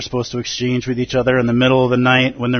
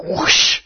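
airy, voiceless swish; sword whoosh. Retold or imitated. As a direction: imitate a blade cutting the air. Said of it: "Manjushri's sword is like".

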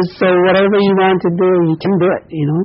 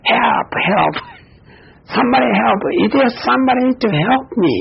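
mock cry for help; 5 semitones higher than usual. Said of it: "he screamed".

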